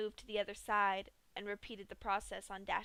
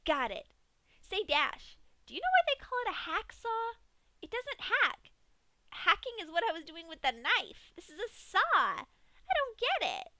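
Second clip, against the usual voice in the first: much higher, louder bubbly Pinkie voice; chatty Pinkie; 10 semitones higher, 8 dB louder than usual. A narrator's reading. Pinkie chatting to Dash about the hacksaw, high and bubbly. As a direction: babble the hacksaw joke brightly.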